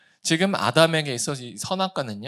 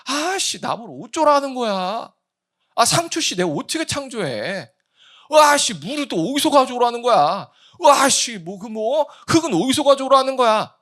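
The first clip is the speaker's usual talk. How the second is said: higher, louder exasperated cursing; frustrated grumbling; 8 semitones higher, 6 dB louder than usual. Retold or imitated. Preacher mimics someone cursing at God's demands. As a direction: grumble bitterly at an impossible task. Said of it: "이거 모르면 뭐예요".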